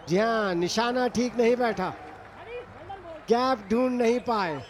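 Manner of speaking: ordinary delivery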